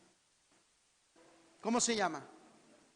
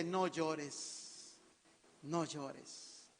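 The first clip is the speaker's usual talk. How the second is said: low, soft and hushed; gentle, lowered voice of consolation; tender comforting; 4 semitones lower, 8 dB quieter, much slower than usual. Retold or imitated. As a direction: quietly soothe someone who is weeping. Voices the Lord comforting the widow.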